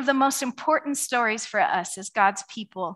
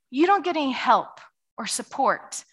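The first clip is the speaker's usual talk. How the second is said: usual speaking voice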